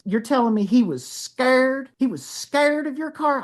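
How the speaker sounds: high-pitched, drawn-out disbelief; mock incredulity. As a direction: question it slowly, stretching the word scared. Retold woman's disbelief at the warning.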